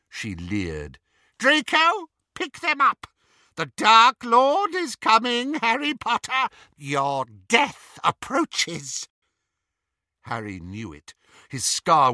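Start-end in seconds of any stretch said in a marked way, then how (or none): 1.4-9.1 high, loud cruel witch taunt, slowing at the end; mocking villainess; Bellatrix voice. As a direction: gloat over the coming doom.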